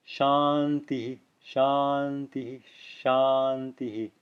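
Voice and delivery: each shanti ends in a short echoed hi; slow shanti, shanti, shanti, each said as shanti-hi; clear visarga echo. Shown as a demonstration. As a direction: sound a breathy echo of the i after every shanti. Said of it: "you're hearing a clear visarga echo".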